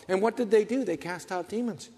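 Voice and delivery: ordinary delivery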